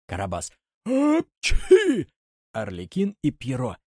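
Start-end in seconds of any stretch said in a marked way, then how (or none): 0.8-2.1 big high sneeze; loud sneeze. Acted. Karabas sneezing at the fire. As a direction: let the sneeze explode.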